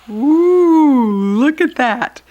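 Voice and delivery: an amazed gasp of pleasure; delighted ooh. Acted. Onlooker watching Claudette pour, high loud ooh.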